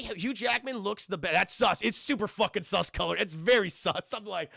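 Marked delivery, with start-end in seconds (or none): none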